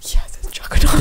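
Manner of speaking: whispering. Retold or imitated. Dan's deadpan aside in cinema.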